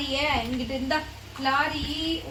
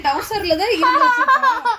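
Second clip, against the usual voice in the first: laughing; 6 semitones higher, 6 dB louder than usual. Real, not acted. Mother laughs during the prank.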